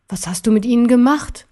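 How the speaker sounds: ordinary delivery, nothing marked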